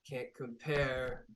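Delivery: slow, smug repeat of the taunt. Acted. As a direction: savour each word like a sneer. Repeats taunt slower, smugly.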